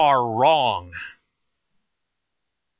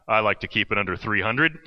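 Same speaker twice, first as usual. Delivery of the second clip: low-pitched big guy impression; Hank; 9 semitones lower than usual. Huge older camper answers camp leader, low imitated voice. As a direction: drop your voice for the hefty wrestler. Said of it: "And Hank responds".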